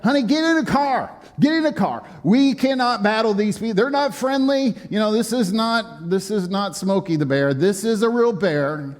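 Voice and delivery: high, urgent calling to his wife; urgent plea. Retold or imitated. Pastor replays urging his wife into the car, high and urgent. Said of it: "I was like".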